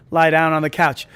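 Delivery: ordinary talk, nothing marked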